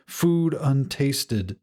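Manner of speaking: ordinary delivery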